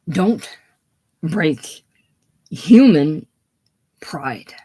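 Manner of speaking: panting, broken words with long pauses; out of breath. Acted. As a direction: gasp each word out, breathless. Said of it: "leans panting for breath".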